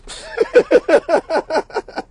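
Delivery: high, rapid cackle; high-pitched run of laughter; amused laugh. Acted. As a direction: burst into a long giggle. Laughs after mocking the judge.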